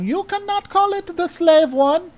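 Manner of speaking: high, loud mock-German officer; high-pitched, forceful German accent. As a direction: order them about in a hammed-up German accent. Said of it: "That's my German accent".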